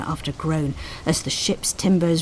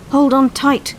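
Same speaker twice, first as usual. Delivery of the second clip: high, loud warning cry; urgent loud cry; cried out; 9 semitones higher, 7 dB louder than usual. A narrator's reading. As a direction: shout a warning over falling spars. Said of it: "cried the coxswain".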